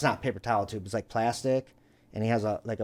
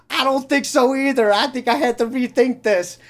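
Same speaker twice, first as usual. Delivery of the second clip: high, loud deafened reply; mock yelling back; 10 semitones higher, 5 dB louder than usual. Man with ringing ears answering his neighbor, mock yell. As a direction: shout your agreement back across the yard.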